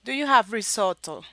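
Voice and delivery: loud test question, risotto lightly Italian-accented; a touch of Italian accent on risotto; attempted Italian accent. Shown as a demonstration. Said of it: "need to use the Italian accent".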